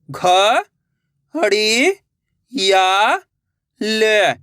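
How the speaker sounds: घ, हड़ी, या, ल drawn out with gaps; syllable by syllable. Shown as a demonstration. Teacher breaking a word for learners, syllable by syllable. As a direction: sound out घ, हड़ी, या, ल singly. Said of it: "एक अक्षर का उच्चारण करके".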